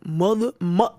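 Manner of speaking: imitating a New Yorker saying mother; a light mock New York accent on mother. Memphis rapper mimics how New Yorkers say mother, New York accent. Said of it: "They be like".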